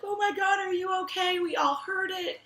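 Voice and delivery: high-pitched, loud coworker fussing; acted-out shocked sympathy; gushing concern. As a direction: exclaim it way up high, mimicking startled coworkers. Coworkers react, laughing and concerned.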